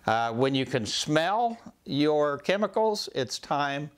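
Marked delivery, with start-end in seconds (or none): none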